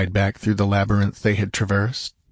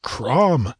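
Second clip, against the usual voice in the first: swearing; 4 semitones higher than usual. Acted. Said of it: "swore Conan".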